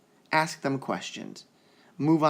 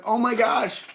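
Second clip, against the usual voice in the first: amazed; 9 semitones higher, 5 dB louder than usual. Retold or imitated. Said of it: "And I'm like".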